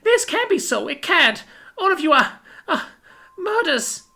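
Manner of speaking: very high, small, faltering voice; tiny distraught child; horrified little girl. A narrator's reading. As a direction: stammer in disbelief at the killers. Horrified child learns of murders.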